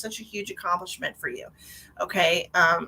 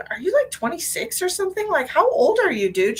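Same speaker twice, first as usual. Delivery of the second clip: surprised; 8 semitones higher, 3 dB louder than usual. Real, not acted. Surprised at sitter's young age.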